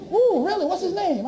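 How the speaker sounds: high, eager lady's question; intrigued lady. Retold or imitated. The lady asks who the speaker is, intrigued. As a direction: lift it up, curious and keen.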